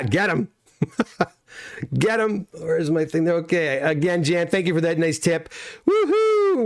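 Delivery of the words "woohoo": happy yelp thanking a viewer for a tip; excited cheer. Real, not acted. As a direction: whoop it up for the tip.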